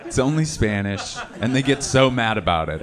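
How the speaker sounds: ordinary talk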